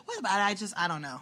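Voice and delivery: ordinary talk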